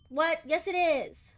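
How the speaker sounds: loud, sharp comeback; loud defensive retort; indignant retort. Real, not acted. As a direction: snap back at the accusation. Arguing back at the game.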